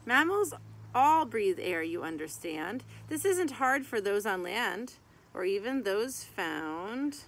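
lively sing-song rhyme reading; reading to children. Acted. Reading a mammal picture book to kids, lively sing-song. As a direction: read the rhyming verse out to little kids.

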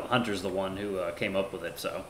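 usual speaking voice

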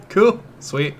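cool said through a real laugh. Real, not acted. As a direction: break into laughter on it. Laughing at game dialogue.

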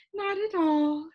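high, slow lady's voice; assuring. A narrator's reading. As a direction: reassure him in a high, lilting character voice. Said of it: "she assured him".

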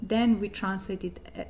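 ordinary talk

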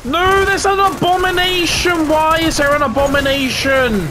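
high, alarmed cry of disbelief; shocked yelp at the sudden monster; real high-pitched alarm. Real, not acted. Spotting an abomination zombie.

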